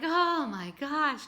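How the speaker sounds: dad reacting to twin granddaughters; dad stunned. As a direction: let the shock land. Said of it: "And he's like".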